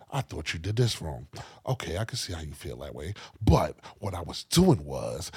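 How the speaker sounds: low, very soft, measured reasoning; calm argument. Retold or imitated. Son imitating parents' calm arguments, low and soft. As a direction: argue gently, keeping the voice right down. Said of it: "they had calm arguments".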